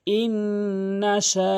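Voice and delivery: ইন্নাশা with the final vowel held long; long drawn madd. Shown as a demonstration. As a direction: stretch শা for one alif. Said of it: "মার করে দেখিয়ে দিয়েছি". (টেনে পড়া)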